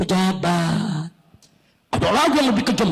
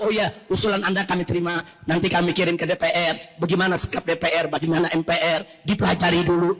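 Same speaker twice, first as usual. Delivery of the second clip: lower-pitched, composed official's answer; measured official reply; 4 semitones lower than usual. Acted. Official replies to retired generals' proposal, measured and lower.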